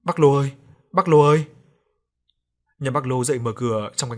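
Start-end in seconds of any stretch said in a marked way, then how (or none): 0.0-1.5 louder, urgent call at the door; raised, insistent shout. A narrator's reading. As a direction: call to rouse a sleeping neighbour. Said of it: "chạy qua nhà bác Lô gõ cửa".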